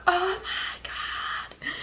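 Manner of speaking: very high, hushed, drawn-out exclamation; mock scared gasp. Kids hearing strange dropping noises, high hushed mock fright. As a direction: stretch it into a soft mock wail. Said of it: "It was so scary".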